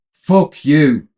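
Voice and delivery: loud, high-pitched furious yell; angry shout. Acted. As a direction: shout it in anger into the phone. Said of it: "she shouts two words into the mouthpiece".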